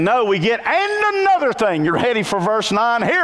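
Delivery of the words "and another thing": lecturing dad. Retold or imitated. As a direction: play a dad who won't stop lecturing. Dad's lecture voice again.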